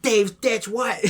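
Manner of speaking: high-pitched put-on outburst; exaggerated high mock bafflement; mock meme exclamation. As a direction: exclaim it high, imitating a video reaction. Acts out mocking meme reaction.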